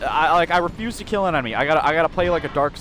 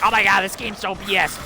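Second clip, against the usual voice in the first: higher-pitched imitation of a griping gamer; mocking gamer complaint, pitched up; mock complaining player; 6 semitones higher than usual. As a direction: lightly mimic a player whining about the game. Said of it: "a Dark Souls two, like, player would be like".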